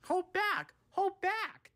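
high-pitched, loud character yell. A narrator's reading. Trucks hold Boko back on the hill, yelling. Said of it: "they yelled".

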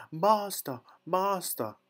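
high-pitched alarm call from the harp; crying out. A narrator's reading. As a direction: call out high for your master. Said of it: "the harp cried out loudly".

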